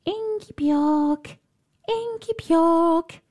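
high, slow drawn-out call. Acted. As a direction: call her name slowly from afar. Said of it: "she heard a voice calling her".

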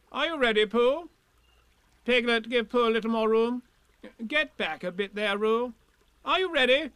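high-pitched bossy commands; Rabbit. A narrator's reading. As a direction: take charge officiously. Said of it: "it was time he took command".

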